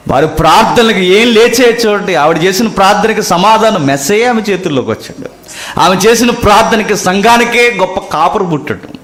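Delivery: high, loud pulpit cry; soaring sermon voice; fervent preaching. Acted. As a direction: preach it out with full force. Preacher raises voice.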